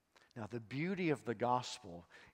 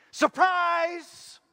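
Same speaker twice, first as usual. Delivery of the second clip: high, loud shout of surprise; spring it on her like a party; 14 dB louder than usual. Acted. Angel pops up before Mary shouting surprise.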